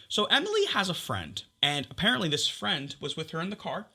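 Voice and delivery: ordinary delivery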